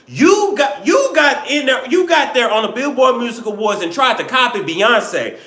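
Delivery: high, emphatic comic scolding; mock outrage. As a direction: call her out in exaggerated, rising indignation. Mock outrage at absent [name].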